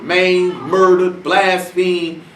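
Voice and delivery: normal talking voice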